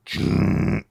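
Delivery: low imitated guitar chug; heavy slow riff noise. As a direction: voice a slow, heavy band hit with the mouth. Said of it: "just super heavy and slow".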